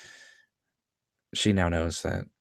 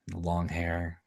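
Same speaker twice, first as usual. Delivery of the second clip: ordinary talk, nothing marked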